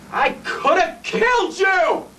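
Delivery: slow, high, drawn-out yell; high, emphatic outburst; horrified shout. Acted. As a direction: shout it word by word, appalled. Shouting at man nearly shot.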